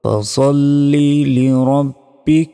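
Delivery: slow, melodic tajweed with long held notes; sustained, unhurried sacred chant; Quran recitation. Shown as a demonstration. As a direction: chant the sura slowly, drawing out every long vowel. Recites the whole sura.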